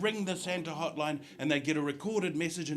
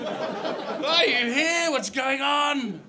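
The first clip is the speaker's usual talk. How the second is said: put-on confused old passenger; 10 semitones higher, 6 dB louder, much slower than usual.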